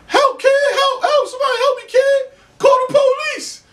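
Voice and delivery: squeaky high mock yell, hammed-up; mock high screaming for help. Beaten man screaming to neighbors, hammed-up high mock cry for help. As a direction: wail for help in a high put-on voice. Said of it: "That's all he's screaming".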